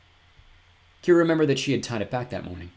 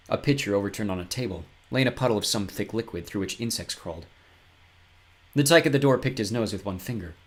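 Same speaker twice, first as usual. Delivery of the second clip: ordinary delivery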